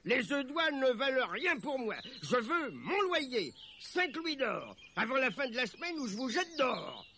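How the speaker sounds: high-pitched, demanding baron; haughty, bad-tempered ultimatum; angry baron. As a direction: threaten them like a landlord owed money. Baron threatens to evict tenants.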